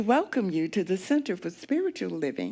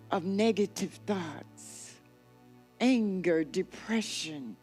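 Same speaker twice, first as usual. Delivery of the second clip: guided meditation; 5 dB quieter than usual. Acted. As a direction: lull them gently down the river.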